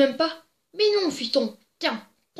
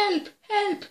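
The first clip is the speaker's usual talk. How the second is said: mock cries for help; 7 semitones higher than usual. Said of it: "en criant".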